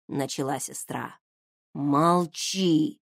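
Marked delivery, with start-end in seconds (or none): 1.7-3.0 hissed hush. Acted. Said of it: "зашипел на неё".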